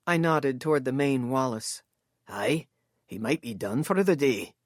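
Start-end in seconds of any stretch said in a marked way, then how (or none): 2.3-4.5 lower, easy Scots voice; relaxed Scot shrugging it off; lightly voiced Scottish man. A narrator's reading.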